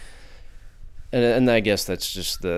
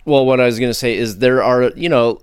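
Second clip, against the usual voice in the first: usual speaking voice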